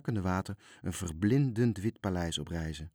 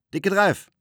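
high, loud captain's shout; calling out; 12 semitones higher, 10 dB louder than usual. A narrator's reading. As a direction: call out sharply to a crew member. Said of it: "riep hij".

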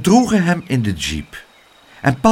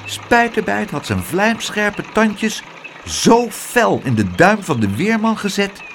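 ordinary delivery

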